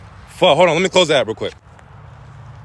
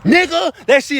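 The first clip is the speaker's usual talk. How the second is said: much higher, louder outburst; excited exclamation; 8 semitones higher, 4 dB louder than usual. Real, not acted. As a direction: blurt it out amazed. Exclaims about his point.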